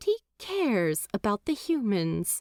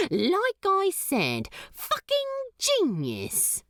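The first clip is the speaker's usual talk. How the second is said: higher, half-teasing demon praise; 11 semitones higher than usual. Acted. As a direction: tease him, but mean it. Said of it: "only half teasing".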